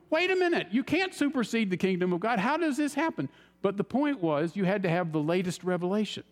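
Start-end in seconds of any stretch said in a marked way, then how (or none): 0.1-3.3 high, startled inner protest; inner alarm. Retold or imitated. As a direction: pitch jumps up in disbelief at what he hears. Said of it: "inside I was going".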